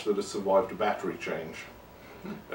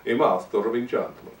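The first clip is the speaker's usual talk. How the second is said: bad, hammy Eastern European accent; 6 dB louder than usual. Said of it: "a very bad Eastern European accent".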